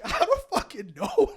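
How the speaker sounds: laughing. Retold or imitated. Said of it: "And I'm just like".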